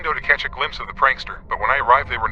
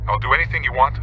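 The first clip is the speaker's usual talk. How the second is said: plain reading voice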